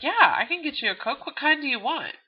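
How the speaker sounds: a hint of an easygoing buddy asking; Bob offering. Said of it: "Bob's like".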